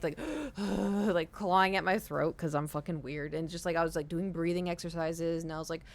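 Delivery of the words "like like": sharp panicked gasp for air; retold panic attack gasp. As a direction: gasp like you can't breathe. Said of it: "I start doing, like, the panic".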